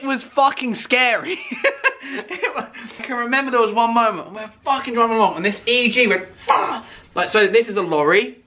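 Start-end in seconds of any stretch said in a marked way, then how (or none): 6.4-6.9 sharp high yelp of fright; sudden high curse; mock exclamation. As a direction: bark it like a driver in shock. Imitating car swerving.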